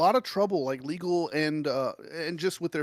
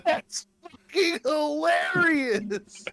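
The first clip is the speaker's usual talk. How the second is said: very high, drawn-out squeal; slow high-pitched wail of delight; highly amused; 11 semitones higher, much slower than usual. Real, not acted. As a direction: stretch it out, overcome with amusement. Cracking up at the joke's payoff.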